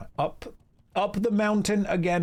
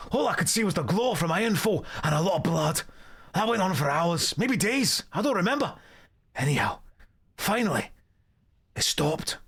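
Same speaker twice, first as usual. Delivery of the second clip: hammed-up Scottish dwarf storyteller; Scottish accent. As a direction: spin the tale in a broad put-on Scottish accent. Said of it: "That was a perfect Scottish accent".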